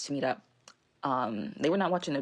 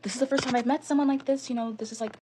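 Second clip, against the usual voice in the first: high, gushing imitation of herself smitten; head over heels; 7 semitones higher, 3 dB louder than usual. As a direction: gush in a raised voice, mocking lovestruck excitement. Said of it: "so head over heels and so whipped".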